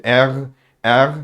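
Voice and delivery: "R" as er with a throat-made R; teacher demonstrating "R" unrolled; German R demo. Shown as a demonstration. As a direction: model "R" from the back of the throat. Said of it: "Hepiniz çıkaramayabilirsiniz bu sesi".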